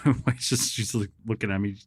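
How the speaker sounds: normal talking voice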